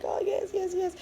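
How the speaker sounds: retold happy confirmation; excited yes. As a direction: gush the yeses. Her excited reply to husband.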